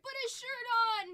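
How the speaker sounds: high, loud mock outrage; mock scolding yell. Joking about a shirtless Gamorrean guard, mock scolding yell. Jokingly yelling at card's figure.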